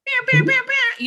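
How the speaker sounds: high, loud repeated pew noises; imitated noise. Co-host teases Gasper as underwater, high mimicked pews. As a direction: squeak out a string of high pews.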